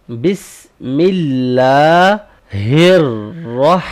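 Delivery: بسم اللہ الرح chanted very slowly, drawn out; tajweed demo; slow recitation demo. Shown as a demonstration. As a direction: recite phrase slowly, stretching vowels. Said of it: "را کو ہم نے موٹا پڑھنا ہے".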